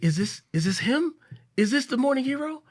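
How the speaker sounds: high, loud, excited questioning; imitated breathless woman. Woman running up to her husband's hero, imitated breathless excitement. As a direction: act out an eager stranger who just ran up. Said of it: "out of breath".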